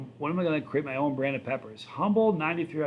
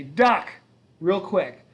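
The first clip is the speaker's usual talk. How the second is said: sudden louder shout of duck with a rise; mock warning shout; 5 semitones higher, 8 dB louder, much slower than usual. Psych-class student startling a passerby, calling out duck. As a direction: bark a quick warning at a stranger.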